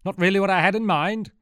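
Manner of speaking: hammed-up, pushing the joke on the soldiers; villain repeats his joke to the soldiers; repeating the joke. A narrator's reading. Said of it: "Get that?".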